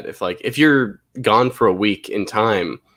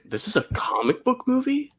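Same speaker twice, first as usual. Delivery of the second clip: surprised; 4 dB quieter than usual. Retold or imitated. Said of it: "and that one, I'm like".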